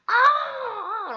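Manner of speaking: high, loud "aah!" of sudden understanding; imitated cry of recognition. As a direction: shriek as if it suddenly clicks, imitating her. Student's reaction on finally understanding.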